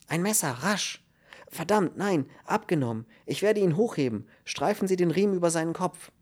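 high-pitched, frantic orders; urgent. A narrator's reading. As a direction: give hurried emergency commands. Frantic rescue of hanged man. (hektisch und drängend)

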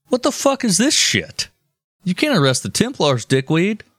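high-pitched mock outrage; indignant pope. Pope confronts king in acted quote.

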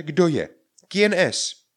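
high, loud demonstration call. Acted. Teacher shows how to answer a knock, calling out. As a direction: call the question out sharply to someone unseen. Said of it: "A vy vykřiknete".

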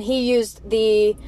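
normal talking voice

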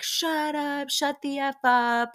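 high-pitched, retold "shut up" chorus; mock group outburst. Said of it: "everyone is just like".

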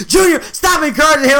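high mock shout; mock scolding. Relatives telling Junior off for encouraging him, mock yell. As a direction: imitate a relative yelling to cut it out.

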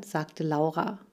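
ordinary delivery

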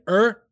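"er" with a sounded R; hard "er". Shown as a demonstration. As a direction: close "er" firmly on the R. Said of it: "If it's at the end".

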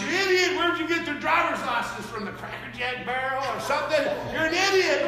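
loud, high angry yell at bad drivers; mock yell at drivers. Preacher yelling out the window at other drivers, in mock anger. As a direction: bark insults out the car window.

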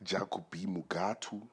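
"Jacobi Mugatu" said softly with a rising end; soft, toned "Jacobi Mugatu", lifting at the end; name with a tone. Shown as a demonstration. Host demonstrating the tone of his name, "Jacobi Mugatu" toned and rising. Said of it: "You gotta have a tone with it".

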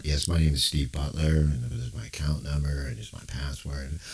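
quiet, resigned caller; customer. Acted. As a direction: play the weary customer reading details. Customer answers the rep.

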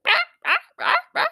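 high, loud frantic duck squawks; imitated panicked quacking. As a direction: squawk like a freaked-out mama duck. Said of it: "she was freaking out".

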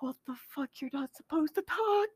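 playfully stunned that a statue speaks; mock shock. Imitating shock at the statue talking.

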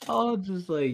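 ordinary talk, nothing marked

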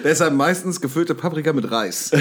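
laughing through the words; breaking into giggles mid-joke. Real, not acted. Joke about never cutting food.

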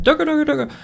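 very high, put-on joke Indian voice; mock foreign gibberish. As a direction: squeal it as a badly faked Indian imitation. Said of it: "It wasn't even an Indian accent".

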